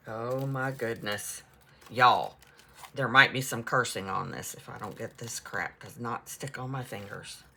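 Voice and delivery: loud, fed-up complaint to the audience; exasperated. Real, not acted. Fed up with sticky fingers.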